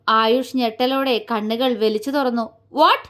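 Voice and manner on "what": sharply rising, loud "what"; shocked yell. A narrator's reading. Ayush hearing the real name from his friend, shocked yell. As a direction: cry out in stunned disbelief. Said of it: "അലറുകയായിരുന്നു അവൻ".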